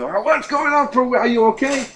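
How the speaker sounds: jolted awake by a midnight call; alarmed answer. Retold or imitated. Rabbi picks up a late collect call, startled and high.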